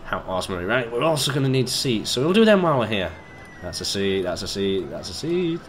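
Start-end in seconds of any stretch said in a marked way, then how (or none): none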